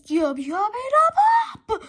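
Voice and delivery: loud, high toilet chant; monster bellow. A narrator's reading. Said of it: "The toilets bellowed".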